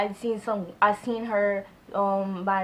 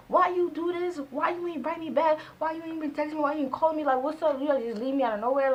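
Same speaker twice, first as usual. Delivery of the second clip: high-pitched retold rant, pitched way up; firing angry questions at the ex; snapping; 7 semitones higher than usual. Girlfriend retelling how she snapped at her ex for ghosting her. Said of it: "Like, I was snapping".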